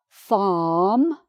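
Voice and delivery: "farm" as "faahm", long vowel rising at the end; British "farm" with silent R. Shown as a demonstration. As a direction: stretch the "ah" in "farm", dropping the R. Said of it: "No R".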